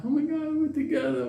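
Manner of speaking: put-on high, light old-lady voice; mock blissful old woman; contented old lady. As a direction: coo it high, imitating her. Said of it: "the old lady".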